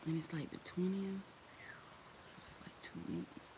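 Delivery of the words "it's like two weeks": whispered to herself. Real, not acted. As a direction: whisper it under your breath while thinking. Muttering while figuring dates.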